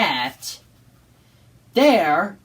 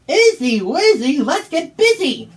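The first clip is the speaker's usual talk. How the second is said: magic spell shout; 7 semitones higher, 6 dB louder than usual. Acted. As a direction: spell called out higher and louder. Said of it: "Pete said the magic words".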